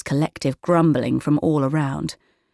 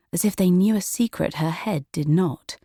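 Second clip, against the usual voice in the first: usual speaking voice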